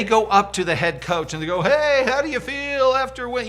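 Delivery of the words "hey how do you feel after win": high, loud call to the winner; mock excited interviewer. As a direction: hail the champion like an eager reporter. Voicing reporters calling to winning coach.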